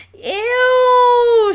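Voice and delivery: high, loud shriek of disgust; girl recoiling from a skunk smell, loud and high; disgusted cry. A narrator's reading. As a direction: shriek it out at the stink. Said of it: "Sheila cried".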